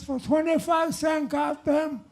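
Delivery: high, loud, hammed-up Chinese accent; rising comic outburst in a caricatured Chinese accent; mock Chinese accent. Shopkeeper scolding a customer over a cheap fan, indignant mock Chinese accent. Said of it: "Chinese guy says".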